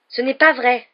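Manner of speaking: loud, high indignant denial; angry. A narrator's reading. Harry denying the rival's taunt, angry. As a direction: snap back hotly at the taunt. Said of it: "répliqua Harry avec colère".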